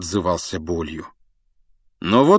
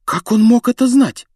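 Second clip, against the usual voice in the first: astonished; 13 semitones higher, 6 dB louder than usual. Acted. Astonished girl asking.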